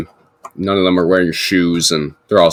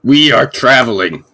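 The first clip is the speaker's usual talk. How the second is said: louder, higher half-orc voice; half-orc piping up; 6 semitones higher, 6 dB louder than usual. Acted. Half-orc interrupting to answer Bug, piping up. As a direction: pipe up with a firm announcement. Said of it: "the half-orc kind of pipes up".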